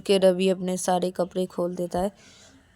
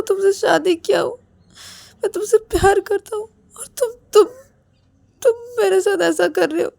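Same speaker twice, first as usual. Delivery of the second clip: high-pitched acted crying, broken phrases; tearful pleading; 10 semitones higher than usual. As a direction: plead through sobs, hurt and sick. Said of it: "Ravi vomiting करते करते बोलते कि". (रोते हुए ऊँची आवाज़ में शिकायत)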